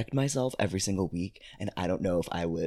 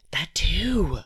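whispering; 9 semitones higher, 8 dB louder than usual. Real, not acted. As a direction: breathe it under your voice. Whispered aside agreeing.

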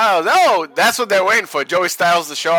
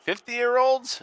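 reading the sign; 6 semitones higher, much slower than usual. Retold or imitated. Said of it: "We got a sign in the front row".

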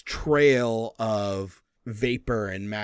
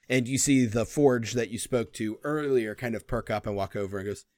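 ordinary delivery

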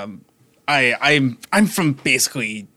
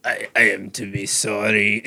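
put-on slipping into the old Francis voice, lower; half-laughing Francis voice; 6 semitones lower, 4 dB quieter than usual. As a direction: apologise in his other character's voice. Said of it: "I immediately reverted back to Francis".

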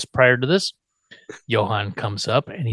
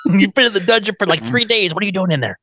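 high, fast, urgent scolding; 7 semitones higher, 3 dB louder, faster than usual. Retold or imitated. Worried wife confronting Herman, urgent and high. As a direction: demand an answer in a rush. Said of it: "like, urgently".

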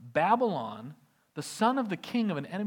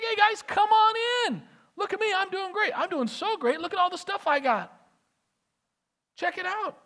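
much higher, bragging host; squeaky over-eager boast; giddy showing off; 11 semitones higher, 3 dB louder than usual. Acted. Flattered Hezekiah shows the envoys his riches, giddy. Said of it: "It's like".

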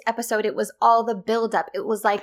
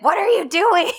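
higher, louder incredulous question; indignant; 8 semitones higher, 5 dB louder than usual. Retold or imitated. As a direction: demand it in mock outrage. Acted confrontation with pursuing man.

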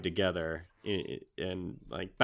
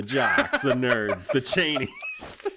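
laughing; 3 semitones higher, 4 dB louder, much slower than usual. Real, not acted. Listing cliques as a joke.

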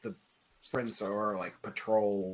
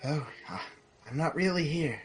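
higher, hesitant dead friend; a lightly acted apparition, pitched up; lightly voiced dream visitor; 7 semitones higher, faster than usual. Game master as dead friend visiting a dream, lightly voiced.

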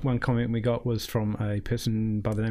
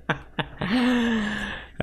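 helium laugh; 9 semitones higher than usual. Real, not acted. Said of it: "This is me on helium".

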